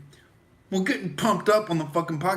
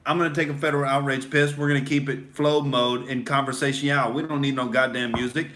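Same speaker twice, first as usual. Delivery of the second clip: normal talking voice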